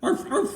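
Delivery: high-pitched yapping arf; boy pretending to be a dog, high yips; playful dog bark. A narrator's reading. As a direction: imitate an excited little dog barking. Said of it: "began to jump about and bark".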